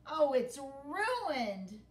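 moaning. Acted. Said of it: "he moaned".

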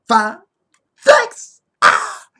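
loud, high celebratory bursts; excited shouting. Real, not acted. Fan celebrating Ippo's win, excited shouting. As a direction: yell it out, jumping up to a high peak.

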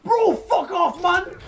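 screaming in fury; furious yell at the screen; raging scream. Real, not acted. Raging at game loss.

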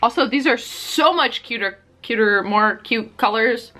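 ordinary talk

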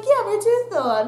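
matter-of-fact to stunned friends; nonchalant reply. Retold or imitated. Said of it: "I'm just like".

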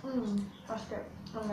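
chewing candy; talking while chewing; mouth full. Real, not acted. Seen 3: left girl put candy in mouth at -2, still chewing.

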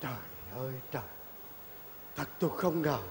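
stunned hush. Acted. Old man stunned by betrayal.

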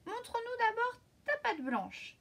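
little goats. A narrator's reading. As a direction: kids demanding proof. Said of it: "lui répondirent les petits".

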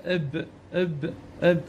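"اب" with sukun on the b; vowelless final b. Shown as a demonstration. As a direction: end each "اب" on b with no vowel. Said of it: "ما يلفظ بحركات".

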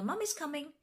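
high, singing-out motherly call. A narrator's reading. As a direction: call upstairs to comfort a child. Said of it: "called George's mother".

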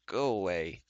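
drawn-out, far-away dismissal; remote, reluctant voice; faint distant voice. A narrator's reading. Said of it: "A faint but distant voice".

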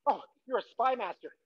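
usual speaking voice

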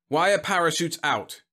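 snapped. A narrator's reading. General quizzing the Secretary, snappish. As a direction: snap the question at him. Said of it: "he snapped".

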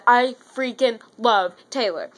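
ordinary talk, nothing marked

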